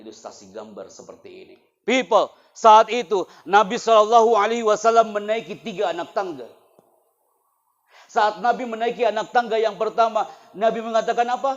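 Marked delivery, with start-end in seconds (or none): 1.9-11.5 loud, high, impassioned sermon; loud, high storytelling sermon about the weeping palm; full-force preaching. Acted. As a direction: preach it with fire to the students.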